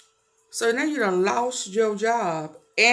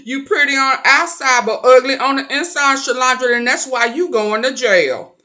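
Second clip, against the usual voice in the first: loud taunting; 10 dB louder than usual. Acted. Loud final roast of Shalandra.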